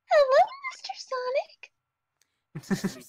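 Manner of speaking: put-on very high, sweet little girl voice; sugary cartoon kid greeting; cute character voice. Shown as a demonstration. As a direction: squeak it out like a shy rabbit girl. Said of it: "and then I can also do".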